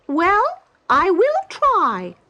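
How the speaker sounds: high, loud, slow bird voice; a curious stork drawing out every word. Acted. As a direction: stork questioning the travellers on the bank. Stork agrees.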